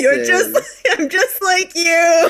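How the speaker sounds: slow, high, laughing. Real, not acted. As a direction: giggle through it. Laughing in character line.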